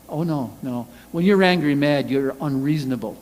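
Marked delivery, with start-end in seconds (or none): none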